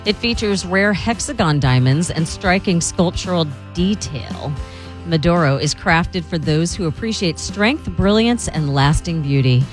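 smooth live ad read over music; luxury ad. Acted.

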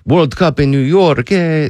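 lightly voiced French fan, sliding into Italian; mock French accent. Host half-doing a French fan's accent. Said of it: "I'm not gonna do a French accent".

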